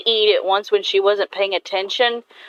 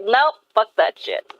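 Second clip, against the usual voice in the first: flat dismissive refusal; 2 semitones higher than usual. Retold or imitated. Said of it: "It's like".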